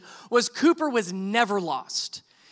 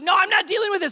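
high-pitched indignant protest; mock-argument refusal; exasperated retort; 9 semitones higher, 3 dB louder, faster than usual. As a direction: push back, pitch jumping up. Arguing back at wife.

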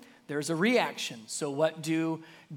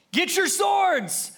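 commanding order; 10 semitones higher, 4 dB louder, slower than usual. Retold or imitated. David orders his men.

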